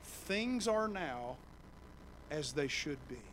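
stretched, slow phrasing; slow and deliberate. Shown as a demonstration. Preacher explaining shalom, slow and deliberate. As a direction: linger on each word. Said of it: "kinda has this idea".

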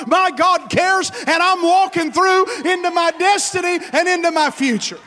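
loud, high, shouted preaching; a loud, soaring Gospel sermon; full-force preaching. Acted. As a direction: preach it to the back row at full voice.

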